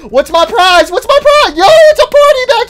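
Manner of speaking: high, loud party whoop; excited cheering. Real, not acted. Gamer finding his prize room, excited cheering. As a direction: shout it giddy, high and loud.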